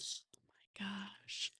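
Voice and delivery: breathy shocked whisper, slow. Real, not acted. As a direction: whisper it in disbelief. Shocked aside to co-host.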